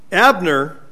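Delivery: normal talking voice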